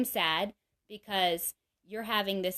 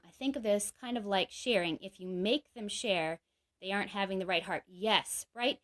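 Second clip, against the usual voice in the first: normal talking voice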